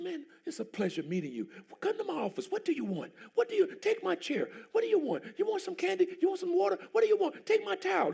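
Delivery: high, eager fussing voice; fawning host. Retold or imitated. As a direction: fuss over the guest. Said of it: "He said".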